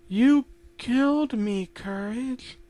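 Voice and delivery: slow, high ghostly accusation; Muriel accusing. Acted. Dead Muriel in the mirror to her dog, ghostly accusing.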